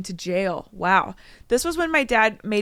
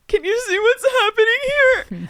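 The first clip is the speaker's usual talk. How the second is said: high, loud indignant cry; hammed-up wail, pitched way up; mock angry outburst; 6 dB louder than usual. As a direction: act out dad's hysterical demand. Reads father's outburst aloud.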